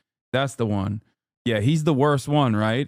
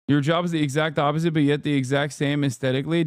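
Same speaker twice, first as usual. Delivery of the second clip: ordinary talk, nothing marked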